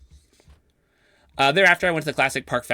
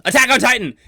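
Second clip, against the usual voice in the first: loud, high shouted quiz answer; imitated yell; 11 semitones higher, 9 dB louder than usual. Conniving friend blurting his anime quiz guess, imitated yell. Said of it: "just yelled".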